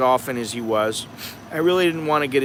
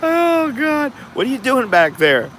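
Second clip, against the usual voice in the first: laughing high mock call-out. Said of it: "she said something to him like".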